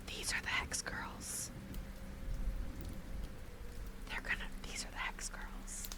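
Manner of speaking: soft ASMR whisper. Pretend.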